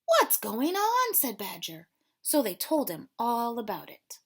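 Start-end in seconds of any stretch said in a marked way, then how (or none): 0.0-1.1 surprised. Acted. Badger asks surprised.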